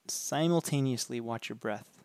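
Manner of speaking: normal talking voice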